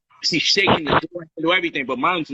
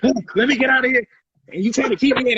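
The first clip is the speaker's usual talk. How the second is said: trapped fury; 8 semitones higher than usual. Retold or imitated. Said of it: "she starts kicking".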